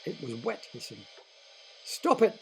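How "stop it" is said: high, loud yell. Acted. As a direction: yell at the car to stop. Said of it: "Roger yelled".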